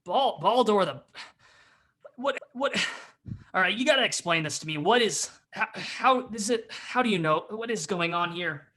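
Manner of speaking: stammering, exasperated gruff demand. Acted. Gruff figure demanding Baldur explain, mildly exasperated. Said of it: "you hear that gruff voice".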